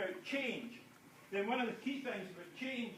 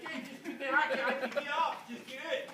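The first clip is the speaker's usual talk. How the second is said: voicing Chris; 6 semitones higher, 4 dB louder than usual.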